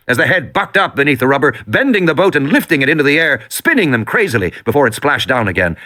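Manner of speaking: plain reading voice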